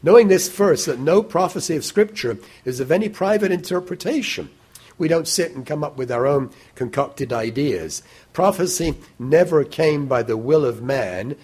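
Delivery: loud, high proclamation that settles down toward the end; preaching at full force. Acted. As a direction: drive the verse home to the congregation.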